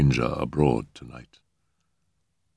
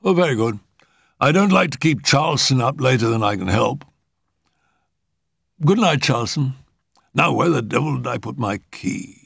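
high-pitched gentleman's voice; high, formal uncle voice; considerate, fussy uncle; 7 semitones higher than usual. A narrator's reading. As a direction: pitch it up, dismissing the driver, then puzzling to yourself.

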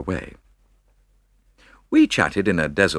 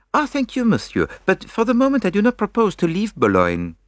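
put-on high, French-accented courteous refusal; polite Belgian detective. A narrator's reading. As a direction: decline the car with Gallic politeness. Poirot politely declines.

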